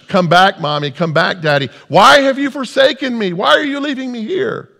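put-on high, loud, desperate child's cry; child screaming. Child to parents being pulled away, screaming. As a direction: cry out as an abandoned child, acted. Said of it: "your child is screaming to you".

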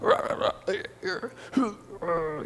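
mock grunting rah rah; imitating wordless grunts. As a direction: grunt like a mute man. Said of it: "grunt and mumble".

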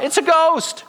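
very high-pitched terrified yelp; frightened cry. Acted. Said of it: "in their fear, they cried out".

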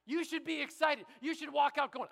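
ordinary talk, nothing marked

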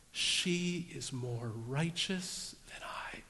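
very slow whispered realisation; hushed confession. Acted. Judah realizing he was to blame, confessing in a whisper. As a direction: whisper it slowly as it dawns.

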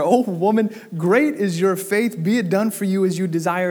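Jesus praises the woman's faith in a ringing voice; proclaiming. Acted. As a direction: declare it with solemn power.

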